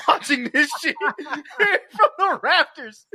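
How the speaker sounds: high, loud words breaking into laughter; laughing hard. Real, not acted. Retelling Bishop on the rooftop, cracking up. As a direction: squeeze the words out through laughter.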